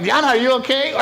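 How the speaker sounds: high, loud laughing shout of her name; laughing call out. Retold or imitated. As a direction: call out to her while cracking up. Calling to aunt, laughing.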